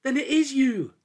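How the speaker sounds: high, loud joyful shout; overjoyed cry. A narrator's reading. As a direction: burst out in delight at finding your friend. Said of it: "was overjoyed".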